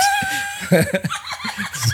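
sudden squeaky laugh; high burst of laughter. Real, not acted. Laughs after wedding story.

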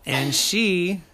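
ordinary delivery, nothing marked

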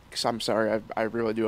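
normal talking voice

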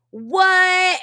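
loud, high-pitched mock disbelief; surprised shout. Retelling the news of a fellow Navy veteran, surprised shout.

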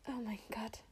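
can barely speak, whisper it in awe; awed whisper. Real, not acted. Overwhelmed by the good news.

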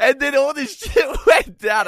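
loud, slow, laughing shout. Real, not acted. Friend recalls ex's cheating, shouting through laughs. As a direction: yell it out through a laugh, drawn out.

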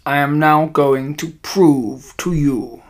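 put-on slow, deliberate villainess with a foreign accent; accented witch leader, stretching out her words; commanding witch addressing her followers.

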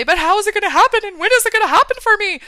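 high, loud frantic whine of worry; mock panic. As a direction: imitate a brain spiralling into panic.

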